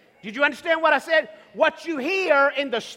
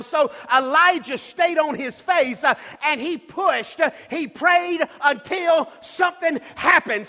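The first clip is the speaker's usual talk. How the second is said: slow, emphatic preaching that builds louder; full-force preaching; 3 semitones higher, 4 dB louder, slower than usual. Real, not acted. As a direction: stretch each key phrase and drive it home.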